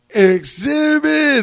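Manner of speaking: Exhibit stretched long and high; hype announcer name call. Retold or imitated. MC introducing the next act to the crowd, drawn-out hype call. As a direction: drag out Exhibit like a stage MC.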